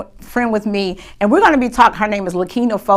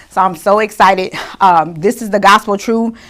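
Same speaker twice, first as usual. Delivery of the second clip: ordinary talk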